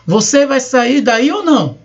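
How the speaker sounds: a demanding question that is really a command; question as order. Shown as a demonstration. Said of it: "Uma pergunta pode ser também uma ordem". (pergunta em tom de ordem, firme)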